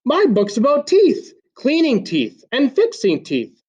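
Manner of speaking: put-on a young girl excitedly listing her dream; eager little girl. A narrator's reading. Amy eagerly describes her book.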